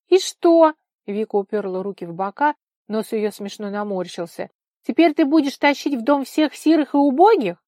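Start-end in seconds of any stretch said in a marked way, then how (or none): 0.1-0.7 high, loud, defiant question; indignant challenge. A narrator's reading. Vika challenges Nikolai, hands on hips. 4.8-7.6 high, loud, scornful question; indignant scolding. A narrator's reading. Vika quarrels with Nikolai.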